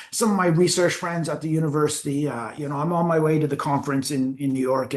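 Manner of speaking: normal talking voice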